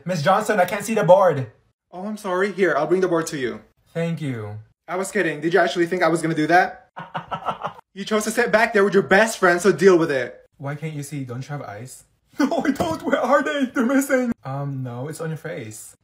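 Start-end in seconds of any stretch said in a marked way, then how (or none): 0.0-1.5 loud complaint. Acted. Complaining loudly to teacher. 4.9-10.4 loud, sarcastic taunt; teacher mocking a student at back. 12.4-14.3 high, loud laughing mockery; student mocking the teacher; laughing sarcasm.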